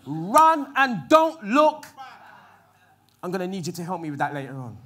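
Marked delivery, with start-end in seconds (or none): 0.1-1.9 very slow, louder order; urgent command. Retold or imitated. Angel ordering Lot's family out, slow loud command. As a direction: stretch each word as a stern order. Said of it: "And the angel's saying".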